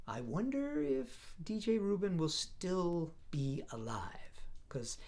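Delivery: slow conspiratorial whisper; hint at a secret, hushed and slow; teasing whisper. Pretend. Teasing the zombie plot twist.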